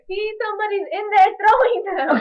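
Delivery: put-on high, loud, laughing kid voice; a mom imitating her son, laughing as she goes; delighted child. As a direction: squeal it as a kid who's just figured it out. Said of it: "and it's like".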